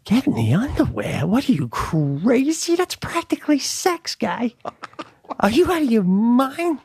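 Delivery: high, hammed-up scandalized voice; high pitched mock panic at the idea; high mock outrage. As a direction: play the shocked husband, way up high. Acted horrified retort to stretcher.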